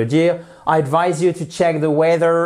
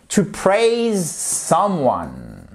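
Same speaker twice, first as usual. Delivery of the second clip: very slow model phrase; much slower than usual. Shown as a demonstration. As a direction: stretch "to praise someone" out slowly. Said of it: "model phrase drawn out". (très lent et articulé)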